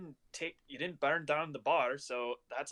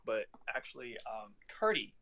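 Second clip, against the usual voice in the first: usual speaking voice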